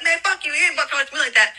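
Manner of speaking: retold high-pitched, fast angry comeback; acting out a mouthy clapback; popping off. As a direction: pop off in a rushed, high voice without shouting. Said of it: "I was popping off like".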